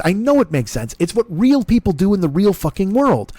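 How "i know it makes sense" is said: normal talking voice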